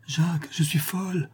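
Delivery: high, breathless, frantic; distraught. Acted. Cécilie tells the notary she is mad for him, frantic. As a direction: sound beside herself. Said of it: "en lui disant éperdument".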